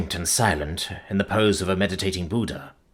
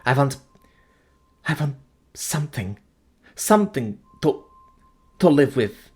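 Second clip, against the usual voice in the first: pleading; 7 semitones higher than usual. Acted. The Intended begging for last words.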